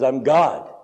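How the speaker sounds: low, understated answer; voice of God. Retold or imitated. God tells the dangling man who he is, low and calm. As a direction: imitate God answering calmly from above. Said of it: "And the voice says".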